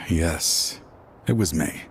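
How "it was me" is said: usual speaking voice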